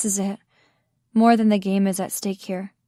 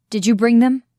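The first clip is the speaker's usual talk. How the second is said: louder, angry question; furious demand; 3 semitones higher, 8 dB louder than usual. A narrator's reading. Damon demands of Gabriel, furious and loud. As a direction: Damon snaps at Gabriel in fury. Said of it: "more furious than I've ever seen him".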